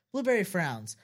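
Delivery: usual speaking voice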